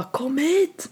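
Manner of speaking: high but unshouted mock call; retold call-out. Calling a frozen friend into the bushes, mock shout. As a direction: call out urgently at held-back volume. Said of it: "jag ropade till henne".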